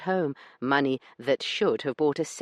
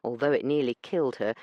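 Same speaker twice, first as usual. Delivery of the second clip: ordinary delivery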